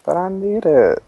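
high, pleading whine; playful whine. Pretend. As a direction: whine it high, begging for the apple back. Pleading for the golden apple.

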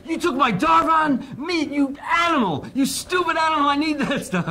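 very high, loud, slow outraged yell breaking into laughter; hammed-up fury, laughing through the end; mock furious yell. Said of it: "I was so furious he took my Darvon".

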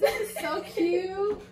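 high, slow squeal; squealing cute. Real, not acted. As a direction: gush over it. Gushing over cute sushi.

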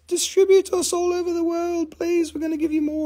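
tiny, very high squeaky creature voice; talking mineral. Acted. Mineral begging its seller in dreams, talking mineral voice. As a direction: plead in a hammed-up high little voice. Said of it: "and it says".

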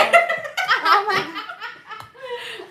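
long, loud high laugh; high burst of laughter. Real, not acted. Risa entre las presentadoras. (carcajada aguda)

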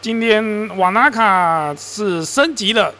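ordinary talk

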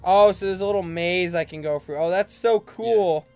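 normal talking voice

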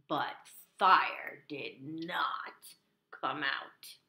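very slow, whispery dramatic narration; hushed suspense. A narrator's reading. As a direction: draw out every word to build suspense. Suspense before the reveal.

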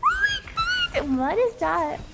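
normal talking voice

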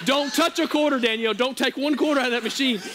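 panicked warning. Retold or imitated. Brandon warning Danielle off the quarters, panicked. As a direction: bark it like the cops are coming. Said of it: "he is pacing the floor".

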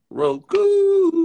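drawn-out mock Jug call of "Roku"; imitating Jug's call. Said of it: "That's the Jug call".

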